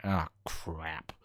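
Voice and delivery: low, soft, drawn-out groan of dismay; imagine a player's sinking dread, stretched out; mock-resigned sigh of the words; dismayed reaction. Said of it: "saw that matchmaking and went".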